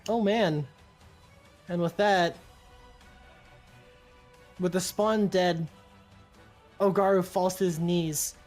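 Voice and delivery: usual speaking voice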